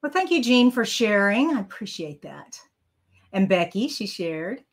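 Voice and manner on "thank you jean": normal talking voice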